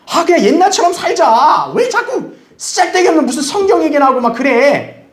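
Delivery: high-pitched mock family nag; exasperated nagging. Family wanting the old life back, exasperated nagging.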